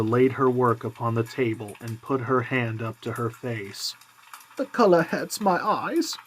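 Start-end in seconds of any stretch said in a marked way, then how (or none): 4.6-6.2 higher, gentle mother's voice; mother hiding her grief behind a higher voice. A narrator's reading. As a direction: voice the tired mother, lifted high. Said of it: "she said".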